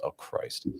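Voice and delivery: hushed, exasperated mutter; eye-rolling exasperation. Retold or imitated. Woman hearing about his motorcycles, exasperated. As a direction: imitate her eye-roll under your breath. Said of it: "she rolls her eyes and goes".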